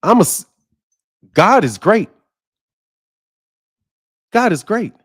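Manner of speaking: ordinary delivery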